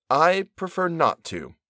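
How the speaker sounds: slow, polite refusal; calmly decline, drawing it out; respectfully and slowly. Acted. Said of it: "he respectfully and slowly said".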